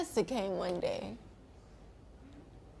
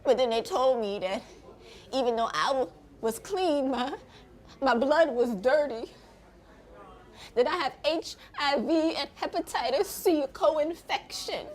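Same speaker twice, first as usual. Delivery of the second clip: ordinary delivery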